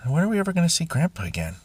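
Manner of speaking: a child's hopeful question, lightly voiced; imitating a child. Quoting his young son asking.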